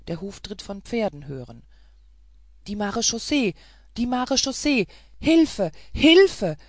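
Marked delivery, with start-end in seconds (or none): 2.7-6.6 rising, ever louder cries for help; high, loud alarm calls, building; screaming for help. A narrator's reading. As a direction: shout desperately for rescue. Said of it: "schrie die Martiniere".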